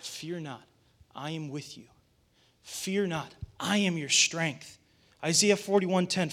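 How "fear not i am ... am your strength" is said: very soft, low-key and unhurried; hushed promise. Acted. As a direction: murmur it tenderly, like a father to his child. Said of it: "He begins to whisper promises".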